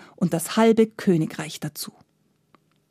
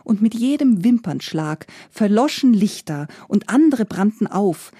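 ordinary delivery

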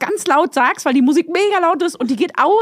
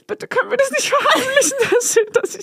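very high, squeaky mock panic; high-pitched, half-laughing retold plea; panicked pleading; 11 semitones higher than usual. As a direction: beg frantically, breaking into laughter. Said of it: "Und ich war so".

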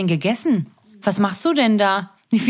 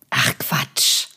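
whisper; 9 semitones lower than usual. Real, not acted. Dismissive whisper.

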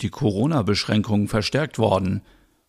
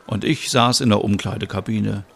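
plain reading voice

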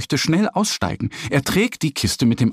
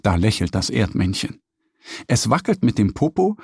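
ordinary delivery, nothing marked

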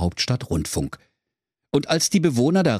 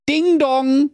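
mock doorbell; 4 dB louder than usual. Höke macht die Ladenklingel.

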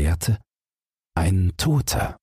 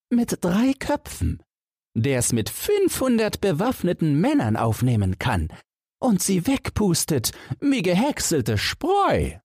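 much higher, far-off sounding cheerful lad; Jack the giant killer; 13 semitones higher than usual. Acted. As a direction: play the confident young Jack, pitched way up. Jack speaks in story.

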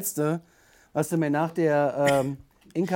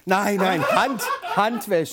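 laughing; 2 semitones higher, much slower than usual. Real, not acted. Joking about washing the mug.

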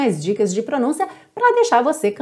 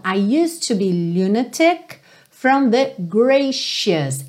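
lyric spoken very slowly, every word clear; slow pronunciation demo; much slower than usual. Shown as a demonstration. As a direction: drill the line slowly for students. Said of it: "Então". (frase da música bem devagar)

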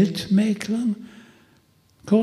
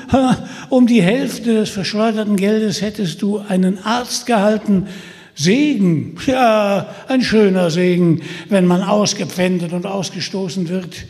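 lauter, höher spottender Versucher; verhöhn Peter mit schneidender Stimme; Holländer Michel, spöttisch; 4 semitones higher, 6 dB louder than usual. Acted. (Holländer Michel verspottet Peter, spöttisch und laut)

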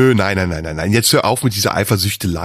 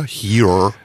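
mock Australian here; 3 semitones lower than usual. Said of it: "australischer Akzent".

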